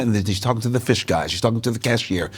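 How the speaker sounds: ordinary talk, nothing marked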